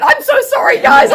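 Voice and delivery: high, loud, gushing apology; real excitement. Real, not acted. Speaker apologising to the group, high and loud with excitement. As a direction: bubble over with excitement. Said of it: "I get so excited".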